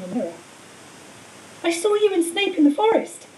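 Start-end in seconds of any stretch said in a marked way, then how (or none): 1.6-3.2 put-on higher and louder, boy blurting it out fast; Harry blurts it out to stall the villain; blurted out. A narrator's reading. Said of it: "he blurted out".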